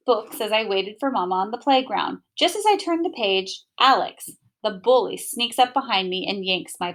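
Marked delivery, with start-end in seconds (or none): none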